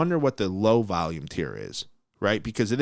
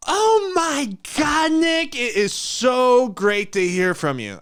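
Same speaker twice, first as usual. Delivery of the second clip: high, slow, gushing mock woman; over-sweet high mock gush; gushing recruiter impression; 7 dB louder, much slower than usual. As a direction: ham up her fake delight, stretched out. Mocking the recruiter's gushing reply.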